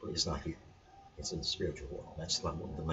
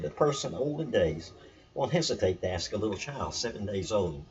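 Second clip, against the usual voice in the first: lifted recitation, a bit higher than talk; scripture reading; 3 semitones higher, 3 dB louder than usual. Acted. Teacher rereading the saying before explaining it, lifted. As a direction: read the verse out as sacred text.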